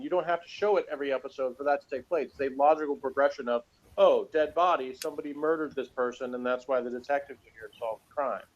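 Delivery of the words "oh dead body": usual speaking voice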